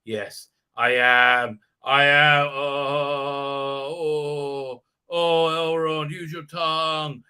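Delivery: long drawn-out, exaggerated moaning; slow, groaning ecstasy, hammed up; mock pleasure. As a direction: play blissed-out and stretch every "oh". Acted blowjob joke, drawn-out moans.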